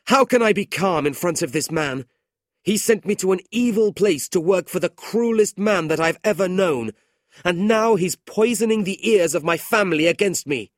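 loud, high angry rant; furious outburst. A narrator's reading. Nicholas rages at his uncle before the family. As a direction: rage at the man. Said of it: "his face red with anger".

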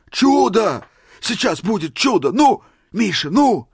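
very high, loud excited cries; ecstatic anticipation. A narrator's reading. Old man sure a miracle is coming, ecstatic. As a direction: urge him on, beside yourself with rapture.

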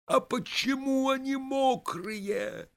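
high, drawn-out wail of alarm; sudden cry. A narrator's reading. Vaska suddenly notices his shoes are wet, crying out. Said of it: "вдруг кричит он".

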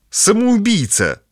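high, slow furious cry; angry outburst. Acted. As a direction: stamp and yell it in fury. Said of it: "топнула ногой Даша".

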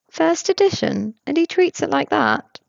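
pry with cheerful curiosity; nosy coworker Tobias. A narrator's reading.

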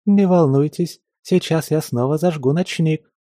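lightly voiced, polite young maid; high sweet maid voice. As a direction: ask sweetly in a high girlish voice. Same stranger voice continues.

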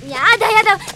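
high, loud struggling protest; protesting yell. Acted. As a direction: shout at him to let go. Struggling out of Marik's grip.

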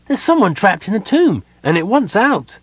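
high, loud and breathless; excited child. A narrator's reading. Kender child telling the crowd, high and excited. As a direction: blurt it out to everyone.

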